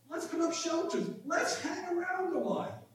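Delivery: high-pitched, eager pleading; imagine an excited disciple urging everyone to stay; excited Peter. Acted. Preacher imagines Peter proposing shelters on the mountain, excited. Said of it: "in his excitement".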